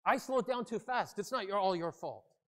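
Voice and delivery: high, loud quarrel over taking blame; angry arguing. Acted. Rear-ended driver insisting on taking the blame, angrily. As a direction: claim the fault in an angry voice. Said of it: "angry faces and angry voices".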